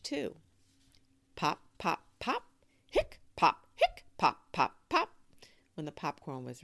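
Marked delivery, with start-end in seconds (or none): 1.4-5.1 loud rhythmic bursts; pops and hiccups. Acted. Narrator imitating noisy dance music, punchy rhythmic bursts. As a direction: punch out each pop and hic.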